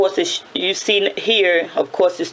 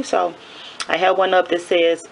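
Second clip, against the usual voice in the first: usual speaking voice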